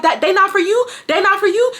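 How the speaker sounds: high-pitched incredulous mom imitation; challenging mom. Mom challenging her child's story about the car, incredulous. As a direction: imitate mom calling out a lie.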